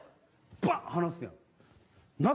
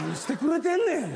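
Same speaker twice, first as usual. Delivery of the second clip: exasperated; 9 semitones higher than usual. Retold or imitated. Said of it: "みたいな".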